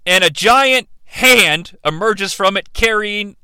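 ordinary delivery

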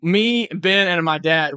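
ordinary talk, nothing marked

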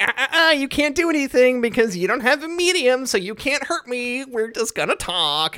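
high-pitched sing-song tut-tutting; taunting demon. Retold or imitated. Demon who cannot be hurt, taunting the hunter. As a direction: hold it high and teasing.

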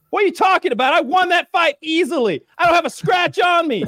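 high, loud, indignant shouted protest; hammed-up outrage, insisting he won; mock Colby yelling he won. Imitating Colby's indignant denial.

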